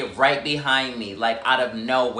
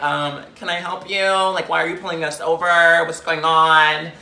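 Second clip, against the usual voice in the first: professional voice; 4 semitones higher, 5 dB louder than usual. Retold or imitated. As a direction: put on exaggerated politeness, building louder. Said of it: "I had to put on my professional voice".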